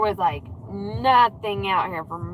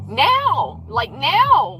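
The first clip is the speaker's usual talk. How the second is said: a half-joking angry order; mock angry demand; 7 semitones higher, much slower than usual. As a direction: boss the phone around, indignant. Yelling at phone assistant.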